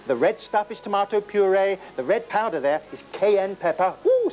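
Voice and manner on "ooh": high hammed-up ooh, spicy; mock excited ooh. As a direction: squeal it up high, comic. Reacts to cayenne pepper.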